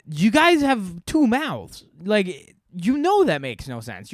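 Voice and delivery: loud, high, incredulous protest; exasperated rant. Acted. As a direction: spell out the obvious, fed up. Rant at the man's silly argument.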